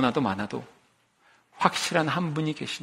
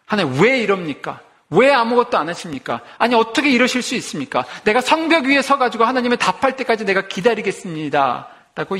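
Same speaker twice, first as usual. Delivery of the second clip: loud, high, challenging plea to God; protesting prayer; 7 semitones higher, 5 dB louder than usual. Retold or imitated. Habakkuk loudly arguing with God from the wall. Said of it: "때로는 대들듯이".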